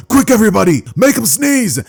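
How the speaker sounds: high, loud urgent order; rally everyone in a hurry; urgent call. Acted. Teacher calling the class to make Deku sneeze, urgent.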